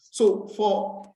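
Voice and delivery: usual speaking voice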